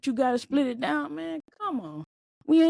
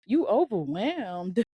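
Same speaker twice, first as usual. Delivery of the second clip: mock disbelief; 3 semitones higher than usual. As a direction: play it up like you can't believe she's overwhelmed. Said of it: "And I'm like".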